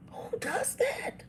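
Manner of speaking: slow, high breathy whisper of disbelief. Retold or imitated. Telling viewers her reaction to the offended cousin, in a whisper. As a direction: whisper it, incredulous.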